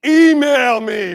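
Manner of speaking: slow, loud, dismissive shout; slow loud brush-off; curt shout. Shown as a demonstration. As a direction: bark it like a brush-off. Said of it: "what do we say as school principals".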